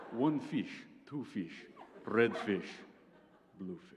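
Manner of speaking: put-on lightly done Russian accent on a children's rhyme. Shown as a demonstration. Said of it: "read them in a Russian accent".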